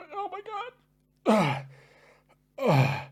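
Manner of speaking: high, slow, drawn-out wail of pain. Real, not acted. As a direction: let the burn stretch the words out. In pain from the pepper.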